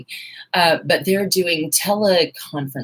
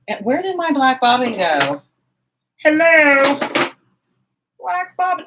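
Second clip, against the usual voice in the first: high exasperated voice; 9 semitones higher, 4 dB louder than usual. Real, not acted. Searching for lost bobbin.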